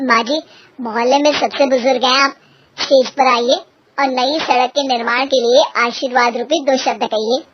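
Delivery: low, male-sounding stage announcer; a deep-set host calling someone up. Acted. Host invites the eldest woman on stage, low announcer voice.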